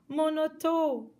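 high, loud dismayed cry. Acted. Guy crying out for his car, loud cry. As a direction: wail for the car. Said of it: "a crié Guy".

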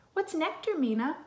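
plain reading voice

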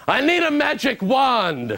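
high and slow, lightly voiced; a hint of a crazy man; mock street oddball. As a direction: ask dreamily for a wand. New York crazy voiced.

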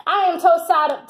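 ordinary talk

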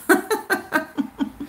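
a high, quick laugh at her son's hollering; amused laugh. Real, not acted. Mother laughing at her son screaming in the ice bath, amused laugh.

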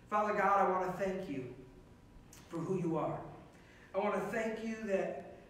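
soft, low, earnest prayer. Acted. Pastor leading his online congregation in prayer, softly. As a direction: pray it quietly to God.

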